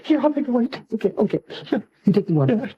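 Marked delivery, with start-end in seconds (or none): none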